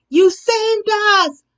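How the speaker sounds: high, loud and slow grateful shout. Acted. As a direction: cry out to the toad in thanks. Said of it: "shouted the frogs".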